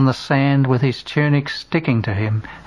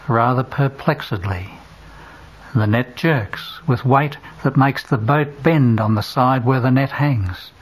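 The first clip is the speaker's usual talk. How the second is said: ordinary delivery, nothing marked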